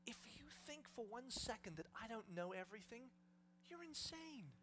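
soft, hushed, intense assurance; Jesus whispering. Acted. Jesus reassuring his followers, softly intense. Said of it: "This is Jesus whispering to us".

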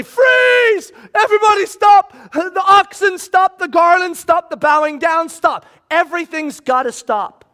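high shout, easing slightly as it goes; urgent shouting. Acted. Apostles running in to stop the crowd, urgent shout. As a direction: burst in to halt everything. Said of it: "running in with a, a dramatic reality".

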